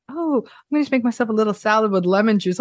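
prim dieter. Pretend. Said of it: "I am not gonna go like".

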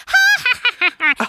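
high, loud mechanical toy giggle; doll giggle. Retold or imitated. Mom imitating the doll's giggling sound. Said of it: "pushed it again and goes".

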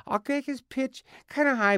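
high, wildly swooping blob voice; Krang pitch demo. Shown as a demonstration. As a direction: push the pitch way up and wobble it. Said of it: "Okay, pitch".

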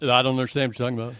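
DJ voice. Acted. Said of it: "your voice".

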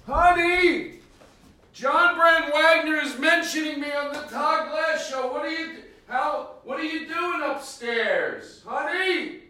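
high wife voice calling upstairs; calling from far away. Acted. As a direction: shout up to someone in another room.

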